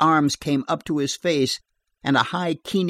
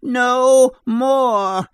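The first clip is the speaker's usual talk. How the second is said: much higher and louder, all-out; furious scream; 10 semitones higher, 8 dB louder than usual. A narrator's reading. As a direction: scream it as the axe comes down. Axe raised to strike.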